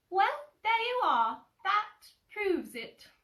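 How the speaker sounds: high, squeaky little Piglet, triumphant. Acted. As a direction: pitch way up as tiny Piglet proving his point. Piglet voiced.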